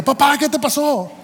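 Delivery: put-on high alarmed child's call; a son's high, worried call. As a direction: imitate a startled son crying out. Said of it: "Y grita".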